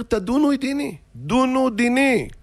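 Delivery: high-pitched insistent plea; crying out. Retold or imitated. Defendant demands to be judged instead of excusing himself, crying plea. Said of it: "צועק".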